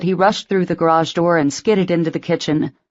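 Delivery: ordinary delivery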